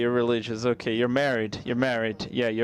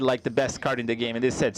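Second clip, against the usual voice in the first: ordinary talk, nothing marked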